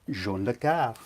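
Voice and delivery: "Jean Le Carre" with a French-style pronunciation; French-style name. Pretend. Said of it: "he's still not French".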